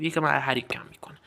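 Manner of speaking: quick whispered aside to himself. Real, not acted. Gamer whispering a side note to himself.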